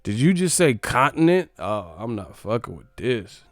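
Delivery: ordinary delivery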